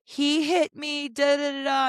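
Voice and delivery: high, drawn-out mock wail of a child; mock screaming. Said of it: "at the top of his lungs".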